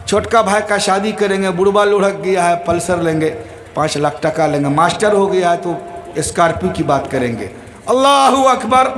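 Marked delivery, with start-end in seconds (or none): 0.1-7.5 low, quick muttered scheming; lightly voiced greedy son counting the inheritance; a son thinking about money at his father's funeral, low and fast; son's inner thoughts. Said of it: "وہ سوچ رہا ہے".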